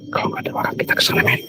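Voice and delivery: low, secretive whisper; hushed planning. Real, not acted. Suggests to companion they go over there, whispering.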